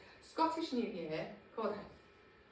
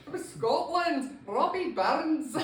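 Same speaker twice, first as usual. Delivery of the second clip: hammed-up slow Scottish accent, higher and louder; mock Scottish accent; 6 semitones higher, 7 dB louder, slower than usual. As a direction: roll it out in an overdone Scottish brogue. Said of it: "Don't do the Scottish accent".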